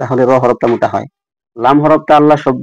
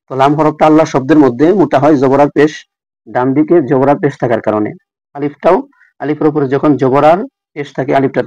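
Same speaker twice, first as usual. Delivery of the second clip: ordinary talk, nothing marked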